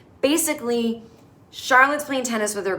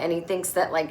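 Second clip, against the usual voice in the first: ordinary delivery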